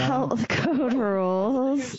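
stretched, deliberate repeat; slowed-down clarification. Real, not acted. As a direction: spell it out slowly for a mishearing. Repeats after being misheard.

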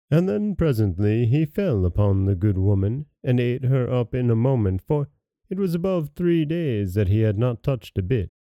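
plain reading voice

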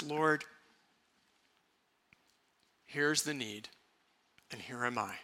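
simple hushed surrender to God; humble, trusting prayer. Shown as a demonstration. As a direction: offer it up quietly, with long pauses. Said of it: "act in humility, in a simple, beautiful trust".

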